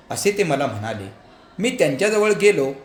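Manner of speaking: plain reading voice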